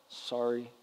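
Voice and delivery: imitating a sulky kid's half-hearted sorry; sulky child. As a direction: mutter it like a kid made to apologise. Child's forced apology to sibling.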